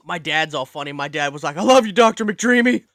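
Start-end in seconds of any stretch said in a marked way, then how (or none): 1.6-2.8 high, loud mock fanboy yell; gushing fan shout. Dad calls out to a TV star, hammed-up adoring shout. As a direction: imitate a dad shouting his adoration.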